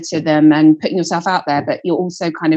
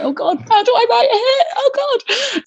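high, laughing mock panic. Songwriter panicking at the demand for a hit, high laughing wail. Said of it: "And you're like".

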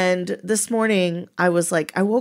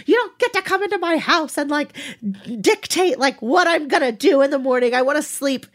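high, fast, angry rant; a grumpy teen venting at her mom; retold blow-up; 7 semitones higher than usual. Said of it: "I just blew up on her".

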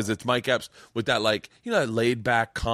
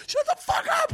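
high laughing yell, voice cracking up; laughing shout. Retold or imitated. On Letterman, cracking up at Dave's face, laughing shout. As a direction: blurt it out through giggles. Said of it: "and I was like".

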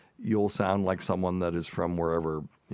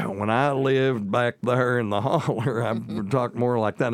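parody Southern accent; 4 semitones higher, 5 dB louder than usual. Shown as a demonstration. Showing listeners how he used to talk back in the holler, parody Southern accent. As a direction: lay the Southern backwoods twang on thick. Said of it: "That's a, a little bit of a parody".

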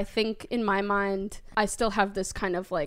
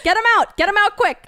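mock yell; 9 semitones higher, 8 dB louder, faster than usual. Jokingly yelling to remove someone.

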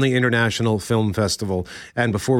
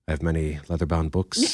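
lower, softer mock Burgundy; 8 semitones lower, 5 dB quieter than usual. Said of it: "The smell of rich mahogany".

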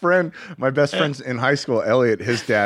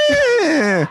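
long high mocking creak; mock door creak; 6 dB louder than usual. Dad mocking his creaking door, high squeal. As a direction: imitate a squeaky door with a drawn-out whine. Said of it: "he mocked the door for creaking".

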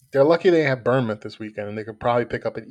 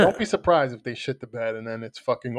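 ordinary delivery

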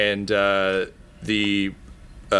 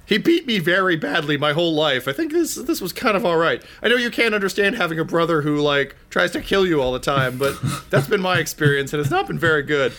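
higher-voiced, chatty character pleading; defensive poop smith; 8 semitones higher, faster than usual. Poop Lord justifying his brother's death, high and defensive. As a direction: plead your case a bit high and casual.

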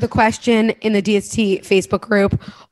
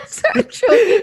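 laughing fit. Real, not acted. Laughing fit over raw carrots.

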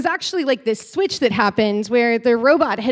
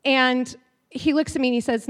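ordinary talk, nothing marked

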